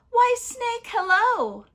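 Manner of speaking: high, loud sing-out greeting; chirpy mouse. Acted. Mouse greets Snake on the path, high and chirpy. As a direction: pipe up a big friendly hello.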